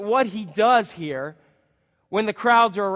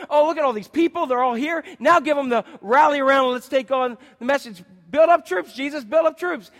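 eager disciples; 6 semitones higher, 4 dB louder than usual. Pretend.

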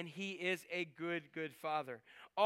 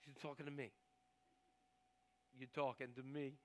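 mock tough guy; 3 semitones lower, 9 dB quieter than usual. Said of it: "I can see him saying".

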